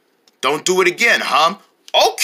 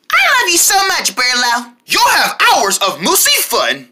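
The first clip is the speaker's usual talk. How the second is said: talking toy voice; 5 semitones higher than usual. Acted. Toy lion says movie phrase.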